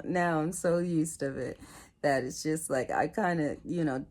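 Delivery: normal talking voice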